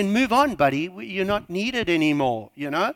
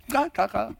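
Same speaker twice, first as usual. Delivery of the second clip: ordinary talk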